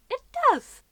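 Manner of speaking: high squealed punchline; exclaiming. Real, not acted. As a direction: burst it out loud and high. Punchline after spoiler alert.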